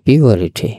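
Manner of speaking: পিউরিটি lengthened to "pee-a-ri-tee"; correct longer pronunciation. Shown as a demonstration. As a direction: model পিউরিটি with a glide before r. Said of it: "উচ্চারণটা একটু লম্বা আছে".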